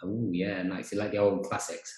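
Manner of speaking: usual speaking voice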